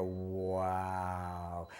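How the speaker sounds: low, hushed, drawn-out wow of wonder; amazed wow. Shown as a demonstration. Showing a child how to react to the beep, hushed amazed wow. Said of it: "I go".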